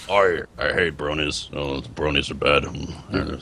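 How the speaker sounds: low, slow grumbling impression; Companion. Showing how to impersonate Companion, deep slow put-on voice. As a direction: drop the voice deep and plod through it. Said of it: "Companion should have been like".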